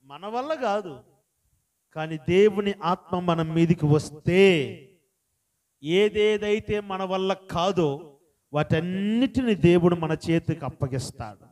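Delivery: loud, slow, ringing preacher's voice; preaching at full force. Acted. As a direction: drive every word home to the congregation. Pastor preaches on.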